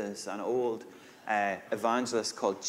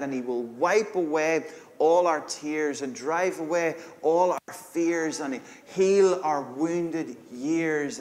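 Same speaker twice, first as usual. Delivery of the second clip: rising preacher's fervour, louder; preaching; 6 semitones higher, 4 dB louder than usual. Acted. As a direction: build it like a sermon climax. Builds reading to full preaching.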